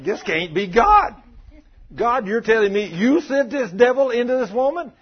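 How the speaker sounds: high, loud, incredulous protest; can't believe what you're hearing; shocked disbelief. Retold or imitated. Preacher retelling his shocked reply to God, loud and incredulous.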